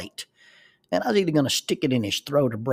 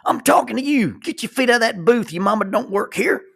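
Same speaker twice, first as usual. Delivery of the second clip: put-on much higher and louder, hectoring a young man; high, loud taunting rant; angry shout; 9 semitones higher, 6 dB louder than usual. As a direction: yell it across the restaurant like a bully. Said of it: "and he's".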